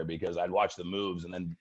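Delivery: ordinary delivery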